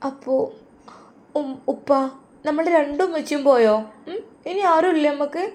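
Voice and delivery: put-on child asking if they are alone now; little girl voice. (ആശങ്കയോടെ കുഞ്ഞ് മകൾ)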